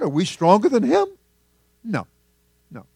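ordinary talk

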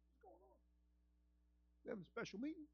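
puzzled Moses. Acted. Preacher voicing Moses confused, softly puzzled. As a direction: wonder quietly what's going on.

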